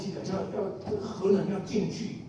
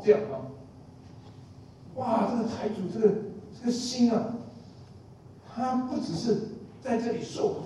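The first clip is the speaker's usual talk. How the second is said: usual speaking voice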